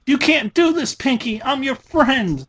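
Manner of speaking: high, pleading cry; desperate plea. A narrator's reading. Captive Dash begs Pinky, high and desperate. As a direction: beg her friend to stop.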